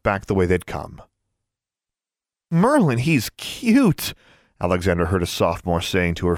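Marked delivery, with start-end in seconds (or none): 2.5-4.1 put-on high-pitched, drawn-out girlish gush; swooning gush. A narrator's reading. Sophomore telling her friends about a cute boy, swooning.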